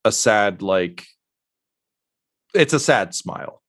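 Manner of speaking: normal talking voice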